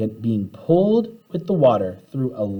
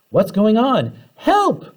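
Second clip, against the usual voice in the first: high panicked cry for help; yelled; 10 semitones higher, 4 dB louder, slower than usual. Acted. Kids shrinking inside the bus, panicked yell. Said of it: "we all yelled".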